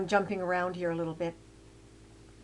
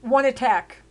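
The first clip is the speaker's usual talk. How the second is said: loud with a falling One; emphatic; 4 semitones higher, 8 dB louder than usual. Real, not acted. Telling of the neighbour who died, louder and emphatic. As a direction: hammer home One attack. Stressing how one attack killed.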